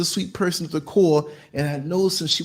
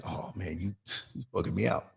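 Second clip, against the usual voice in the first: much softer, lower stunned reply; hushed shock; 8 semitones lower, 13 dB quieter than usual. Retold or imitated. Driver answering the passenger, low stunned murmur. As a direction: mutter in disbelief. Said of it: "And I'm like".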